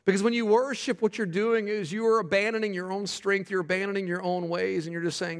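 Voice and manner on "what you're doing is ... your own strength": ordinary talk, nothing marked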